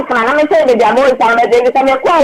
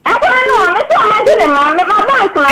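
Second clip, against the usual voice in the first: tearful plea; 7 semitones higher than usual. Real, not acted. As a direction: voice rises high and breaks into crying. Distraught mother asking for children.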